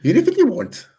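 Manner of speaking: high sing-song Welsh lilt; Welsh accent. Pretend. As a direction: echo it back in Welsh accent. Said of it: "do a Welsh accent".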